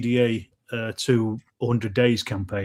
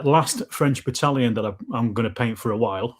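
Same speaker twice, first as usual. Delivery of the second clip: ordinary delivery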